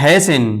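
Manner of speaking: hasten said slowly as "hay-sen"; silent T. Shown as a demonstration. Teacher introduces the word to learners, hasten with silent T. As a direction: say hasten with no T sound. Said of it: "टी के बाद E N है".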